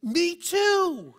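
put-on high, loud child's delighted reply; excited little kid. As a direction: chirp it like a thrilled five-year-old.

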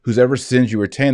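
ordinary talk, nothing marked

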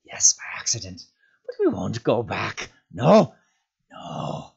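whispery protest rising to a cry; agitated creature, half whispered; panicked creature. A narrator's reading. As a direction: hiss excuses, then cry out in refusal. Gollum panicking about going back.

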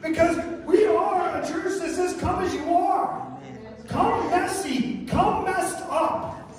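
high, loud impassioned preaching; full-force preaching. Real, not acted. Pastor telling the congregation come as you are, impassioned preaching.

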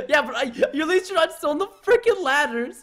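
high, whiny fake exasperation; badly acted frustration. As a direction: overact being stuck and fed up. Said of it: "You're so bad at acting".

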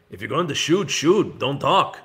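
lightly acted movie gunslinger line; imitating Tuco. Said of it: "he just goes".

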